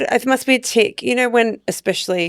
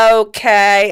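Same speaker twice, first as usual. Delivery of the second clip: loud, drawn-out "okay"; really slow; 5 dB louder than usual. Retold or imitated. Answering a complaint about talking too fast, exaggeratedly slow. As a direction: stretch it out for someone who won't understand. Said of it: "Or I go really slow".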